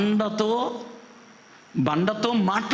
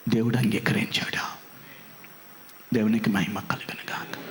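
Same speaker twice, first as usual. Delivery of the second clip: whisper; 12 semitones lower, 3 dB quieter than usual. Real, not acted. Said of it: "hushed praise".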